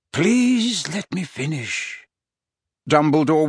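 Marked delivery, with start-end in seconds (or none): 0.1-2.1 slow, deliberate firm request; firm old wizard. Acted. Dumbledore firmly asks to finish.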